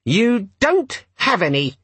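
high, slow squeaky sulk; sulky demon. A narrator's reading. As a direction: sulk slowly in a little gadget voice. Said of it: "said the demon sulkily".

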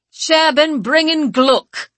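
loud, commanding call across the courtyard. Acted. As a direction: call the servant's name loudly over the crowd. Groom calls out to servant.

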